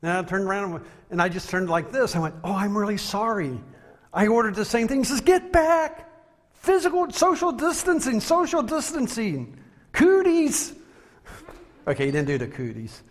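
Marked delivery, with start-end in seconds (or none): 2.4-5.0 high, flustered apology. Retold or imitated. Preacher retelling his apology to an angry customer, flustered. Startled apology to screaming man. 5.2-10.7 very high, hammed-up panicked yelling; mock screaming. Angry customer warding off the man behind him, mock screaming. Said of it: "this man started screaming at me".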